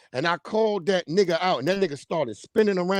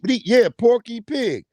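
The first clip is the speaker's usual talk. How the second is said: ordinary talk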